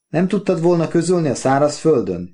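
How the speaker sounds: high, strained call over wind; yelling. A narrator's reading. Wife yells at Oliver over the strong wind. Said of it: "üvöltötte".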